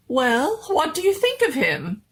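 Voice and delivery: high, eager doctor showing off; proud mad doctor. Acted. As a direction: beam with pride, pitched high.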